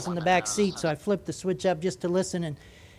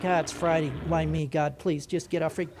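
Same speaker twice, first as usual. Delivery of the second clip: lightly voiced fed-up controller, lower and softer; a mild, under-the-breath plea to be left alone; weary controller; 4 semitones lower, 6 dB quieter than usual. Controller privately begging pilots to get off frequency, mildly weary. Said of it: "And Center likes it".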